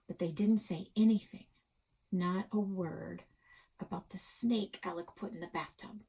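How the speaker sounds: ordinary delivery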